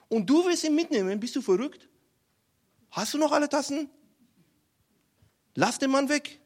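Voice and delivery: high-pitched, incredulous scolding; indignant rebuke. Acted. General scolding the king over David, indignant and high.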